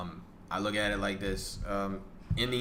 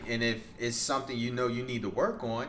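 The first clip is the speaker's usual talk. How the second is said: ordinary delivery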